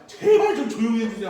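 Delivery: mock-polite request to the noisy crowd; hammed-up polite begging; polite plea. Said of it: "하고 나 왔어".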